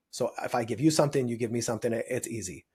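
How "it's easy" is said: ordinary talk, nothing marked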